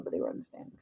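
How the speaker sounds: breathy whisper under her breath; whispered aside. Real, not acted. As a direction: drop to a near whisper. Repeated phrase said unvoiced.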